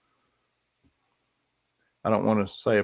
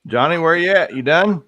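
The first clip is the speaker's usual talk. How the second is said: loud, raised call to someone; 6 semitones higher, 8 dB louder than usual. Real, not acted. Host calling for Johnny on the stream, loud high call. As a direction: holler for a missing buddy, pitched up.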